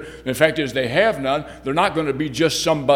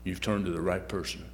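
lower, softer, reassuring voice of God; a calm, lowered divine reply; God speaking; 6 semitones lower, 10 dB quieter than usual. Acted. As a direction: speak down gently as God comforting a child. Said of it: "God says".

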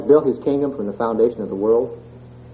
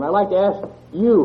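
usual speaking voice

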